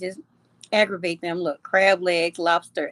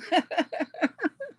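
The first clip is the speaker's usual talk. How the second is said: high laugh; 8 semitones higher, 4 dB quieter than usual. Real, not acted. Laughs after "mad at y'all.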